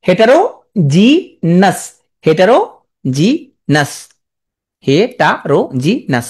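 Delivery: হেটারোজিনাস stretched out very slowly, each syllable clear; slow spelling drill. Shown as a demonstration. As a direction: draw হেটারোজিনাস out slowly for dictation. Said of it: "হেটারোজিনাস সোজা আছে". (হেটারোজিনাস খুব ধীরে টেনে টেনে উচ্চারণ)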